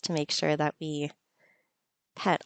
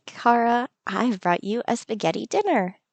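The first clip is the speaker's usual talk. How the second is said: normal talking voice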